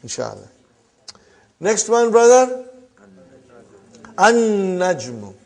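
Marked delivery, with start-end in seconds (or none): 4.2-5.3 An-najmu with a long held "n", no "l"; lam silent. Shown as a demonstration. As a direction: slowly lean on the doubled "n" of An-najmu. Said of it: "You did not pronounce lam".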